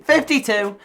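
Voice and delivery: mock-emphatic correction; loud repeat. Repeats age, then louder.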